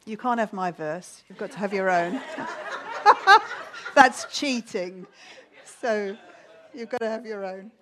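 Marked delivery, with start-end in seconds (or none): 2.3-3.9 high, loud burst of laughter; high giggling laugh; amused laugh. Real, not acted. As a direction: crack up at your own joke. Jokes with the audience.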